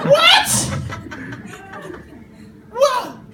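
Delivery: high squealing laugh then a shouted what; shocked delight, laughing out what; surprised laugh. Retold or imitated. Telling the congregation about the birthday surprise, squealing with laughter.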